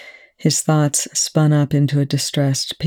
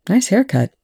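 ordinary delivery

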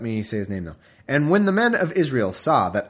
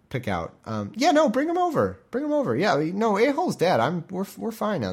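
high-pitched, hammed-up neighbourly chumminess; mock-cheerful, halting social invitation; awkwardly friendly; 9 semitones higher than usual. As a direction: call over a buddy and smooth things over. Acted awkward invitation to fellow soldier.

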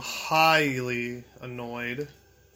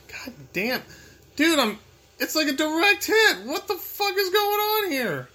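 rising exasperation; 12 semitones higher, 3 dB louder than usual. Real, not acted. Gamer shrieks at a boss in disbelief. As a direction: voice climbing higher and louder in disbelief. Frustrated gamer, pitch climbing.

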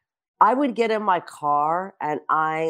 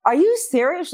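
high, louder questioning jump; incredulous; 6 semitones higher, 3 dB louder than usual. Retold or imitated. Manager hearing her star is happy, incredulous. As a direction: pitch it up in disbelief. Manager's startled reply, retold.

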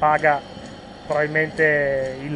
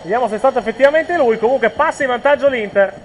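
raised, excited play-by-play; breathless italian goal commentary; 6 semitones higher, 6 dB louder than usual. Real, not acted. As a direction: keep the goal energy up, louder. Commentary right after the goal.

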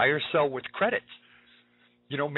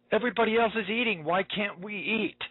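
put-on pleading child; 6 semitones higher than usual. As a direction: plead like a hungry little kid. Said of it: "tears welling up ... saying".

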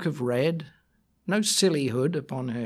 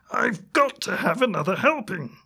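higher, louder yelping wolf; 7 semitones higher, 4 dB louder than usual. Acted. Wolf running round the kitchen, higher yelping wolf. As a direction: yelp it like a greedy wolf.

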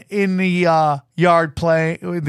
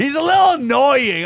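higher, disbelieving echo of the insult; incredulous repeat; 7 semitones higher, 3 dB louder than usual. Retold or imitated. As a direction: repeat it like it's absurd. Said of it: "I'm like".

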